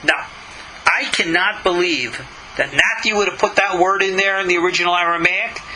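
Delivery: ordinary delivery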